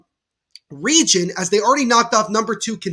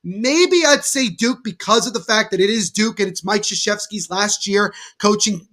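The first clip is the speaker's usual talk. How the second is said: usual speaking voice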